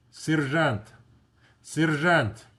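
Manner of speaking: "Sergeant" said in Russian, seer-ZHAHNT; Russian pronunciation. Shown as a demonstration. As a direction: stress the last syllable of "Sergeant", with a zh sound. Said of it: "sergeant первого класса".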